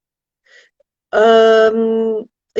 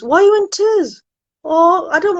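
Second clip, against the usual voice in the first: concerned surprise; 7 semitones higher, 3 dB louder than usual. Real, not acted. Reacting to a viewer's crying message.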